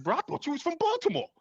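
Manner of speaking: high-pitched comic retelling through laughter; Godfrey. Retold or imitated. Fan retells a comedian's line about an actor's accent, high laughing imitation. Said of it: "Godfrey put it best. He said".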